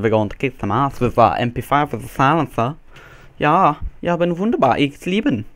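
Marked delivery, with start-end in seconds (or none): 3.4-5.5 higher-pitched put-on German accent; mock German accent. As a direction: play at speaking German with a silly accent. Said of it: "make the accents".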